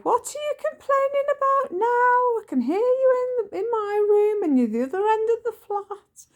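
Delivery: very high, fast squeaky complaining voice; comic falsetto nagging; high mock whine. Son teasing mother from his room, squeaky high and fast.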